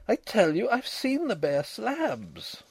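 high, drawn-out frantic insistence; frantic to be believed. A narrator's reading. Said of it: "a little less frantic for his laughter".

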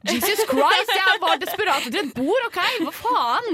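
retelling what she thought of the pushy guy, exasperated; exasperated outburst. Retold or imitated. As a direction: blow up in mock exasperation. Retold exasperated inner outburst.

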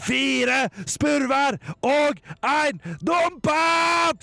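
very high, slow drawn-out scream; shrieking. Acted. Stian screams at the figure, high and slow. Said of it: "skreik han så høyt han makta".

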